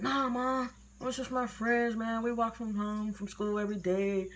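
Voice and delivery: high-pitched reenacted kid protest; innocent excuse. Child explaining friends to mom, imitated innocent denial.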